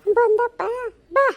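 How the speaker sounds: high-pitched, hammed-up crying complaint; tearful wailing. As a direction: wail to your son as the wronged mother-in-law. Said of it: "ಹಿಂಗ್ ಅಳ್ತಿದ್ದಿ". (ಗೋಳಾಡುತ್ತಾ ಅಳುವ ದೂರು)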